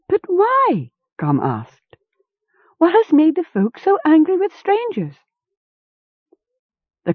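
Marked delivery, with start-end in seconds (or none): 0.1-0.9 Gom's voice. A narrator's reading. Gom asks anxiously. 2.8-5.2 Gom's voice. A narrator's reading. Gom asks anxiously.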